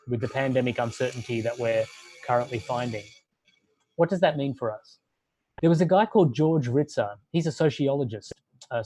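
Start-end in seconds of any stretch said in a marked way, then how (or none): none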